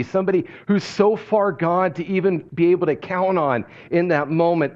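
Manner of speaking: ordinary talk, nothing marked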